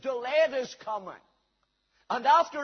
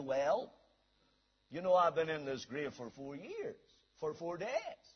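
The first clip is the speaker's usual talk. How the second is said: low, soft, hesitant reply from the tomb; resigned Lazarus; 8 semitones lower, 7 dB quieter than usual. Acted. Lazarus explaining his time in the grave, low and subdued. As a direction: answer quietly, well down in pitch.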